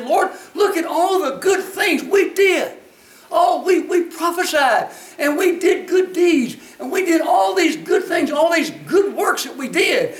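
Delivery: high, insistent protest of good deeds; pleading self-justification. Acted. Acted plea at the judgment.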